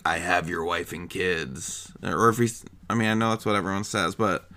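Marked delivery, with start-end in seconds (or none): 0.0-1.9 low, soft, slow menacing caller; voice changer voice. Acted. Man with voice changer voice phoning, low and ominous. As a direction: drop low and drag it like a disguised kidnapper. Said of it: "he has a voice changer voice".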